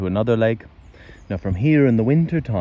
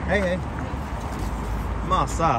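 shouting higher after the dog; calling out; 7 semitones higher, 4 dB quieter than usual. Real, not acted. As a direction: call the dog back across the park.

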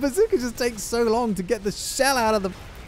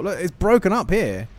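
ordinary talk, nothing marked

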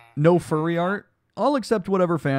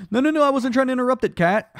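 higher and louder, rushed; flustered protest; 10 semitones higher, 5 dB louder, faster than usual. Real, not acted. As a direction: rush out a flustered denial. Hastily denying interruption.

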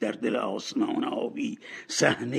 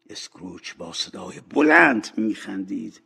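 ordinary delivery, nothing marked

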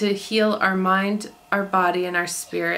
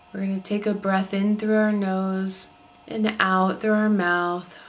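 calm, unhurried meditation voice with long pauses; soothing body-scan narration, evenly paced; guided meditation; 4 dB quieter than usual. Acted. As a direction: guide listeners gently into relaxation, leaving space between phrases.

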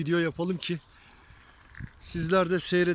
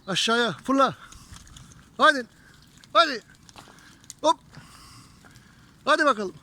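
loud, high herding calls; calling out; 5 semitones higher, 4 dB louder than usual. Real, not acted. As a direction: drive the flock on. Herding the sheep downhill.

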